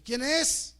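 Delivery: high loud call through the door; shouted question at a door at night. Retold or imitated. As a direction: call out who is there.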